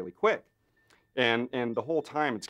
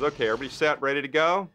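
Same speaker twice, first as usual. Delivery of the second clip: calling out to the crew; 5 semitones higher, 5 dB louder, faster than usual. Retold or imitated. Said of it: "John says".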